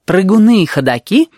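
high, emphatic schoolteacher delivery; teacherly tone. A narrator's reading. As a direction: announce it like a teacher. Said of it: "сказала она учительским тоном".

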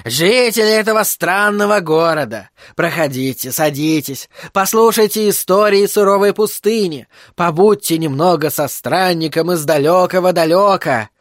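high, theatrical circus patter; grandly declaimed welcome to the audience; loud showman's proclamation. A narrator's reading. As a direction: call the crowd in like a sideshow performer. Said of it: "громко воскликнул он".

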